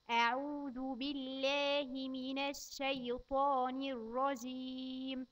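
put-on slow, careful recitation for a child to repeat. Shown as a demonstration. Teacher reciting slowly for a child to repeat. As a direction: spell out each word slowly for the child. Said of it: "نأكد تاني".

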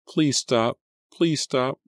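high, loud frightened pleading; panicked high-pitched plea; desperate begging. A narrator's reading. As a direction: beg for it to stop, voice shooting up. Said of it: "Selena begged".

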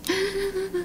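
soft gasp of shock. Real, not acted. Reader shocked by Annie's message, soft gasp. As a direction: gasp quietly in alarm.

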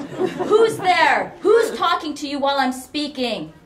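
high, shouted interrogation; outraged demand. A narrator's reading. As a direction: shout at the intruder on the line. Mother demands who is interrupting.

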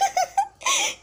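high shrieking panicked laugh; laugh-scream in panic. Real, not acted. Panicked escape in game.

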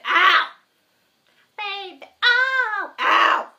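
sharp loud yelps of pain as the dog bites. Real, not acted. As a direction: cry out ow, really bitten.

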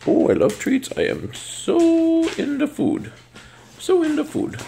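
high, slow goofy voice for the dog; dog's voice. Pretend. Owner voicing her dog's love of treats, high and goofy. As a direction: speak for the dog as a food-crazy pup.

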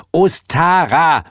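high, loud, stretched OSTARA; irritated, drawn-out name in a high voice; annoyed repetition. A narrator's reading. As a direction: repeat OSTARA slowly, exasperated. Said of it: "wiederholte Heel genervt".

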